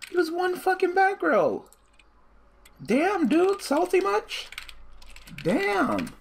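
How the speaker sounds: high-pitched incredulous yelp; loud, high hammed-up indignation; mock outrage. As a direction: squeal in exaggerated disbelief at the play. Mock outrage at opponent's play.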